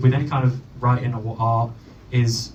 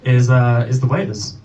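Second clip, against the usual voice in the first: normal talking voice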